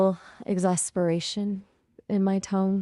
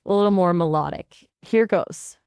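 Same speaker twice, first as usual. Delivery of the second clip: ordinary talk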